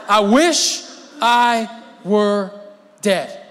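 slow, loud, drawn-out lament; milk the self-pity; melodramatic Jonah. Acted. Preacher voicing Jonah's hammed-up wish to die, slow and loud.

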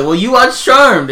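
high, slow sarcastic jab; mock comeback. Mimicking the kids firing back at the dad, slow and high. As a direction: drag it out, teasing back high.

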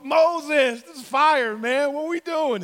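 hammed-up cry for help; crying out in alarm. People cry out to Moses about fire, acted alarm. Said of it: "the people cried out to".

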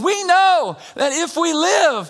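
high, loud shouted preaching; full-force preaching. Real, not acted. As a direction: lift the church with a shout.

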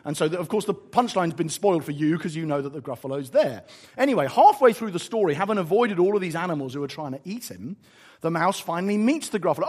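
normal talking voice

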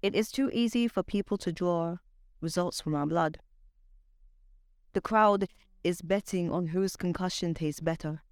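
measured spoken word delivery; poetry reading. Acted. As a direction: perform it like a slam poem, savoring lines. Spoken word poem recital.

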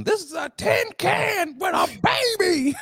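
very high-pitched, strained mock outburst; imitating ranting passenger. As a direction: squeal it like a fed-up airline passenger. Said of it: "You sound like that dude".

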